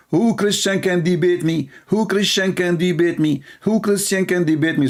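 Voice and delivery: mocking challenge. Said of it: "he keeps saying".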